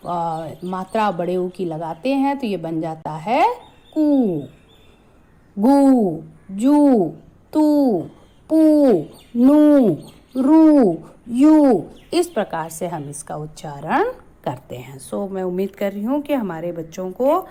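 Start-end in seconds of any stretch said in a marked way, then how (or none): 4.0-11.8 कू गू जू तू पू नू रू यू each with a long, loud, held ऊ; long u sounds. Shown as a demonstration. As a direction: stretch the ऊ in every syllable, louder and rising. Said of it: "इसे हम आराम से बोलते हैं". (बड़े ऊ को लंबा और ज़ोर से खींचकर बोलें)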